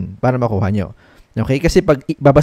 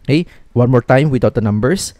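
normal talking voice

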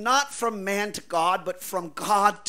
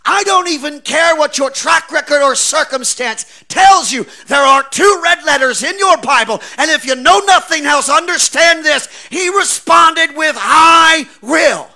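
loud, higher, building shout; preaching at full force; 9 semitones higher, 14 dB louder than usual. Real, not acted. Preacher shouts the climax to his congregation, full force. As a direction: hammer it home to the congregation.

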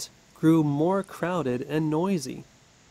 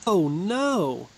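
cried out; 4 semitones higher, 3 dB louder than usual. A narrator's reading. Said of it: "cried the man".

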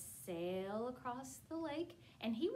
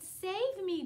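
higher, louder plea; cry for help; 8 semitones higher, 8 dB louder than usual. Acted. Peter sinking calls Jesus, louder plea. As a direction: call out as you sink.

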